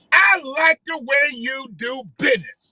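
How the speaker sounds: slow, loud gangster line quoted with relish; movie gangster quote. Retold or imitated. Reliving a favorite movie gangster's line, slow and loud. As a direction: savor it like the menacing gangster. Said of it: "He was the gangster in The Four Brothers".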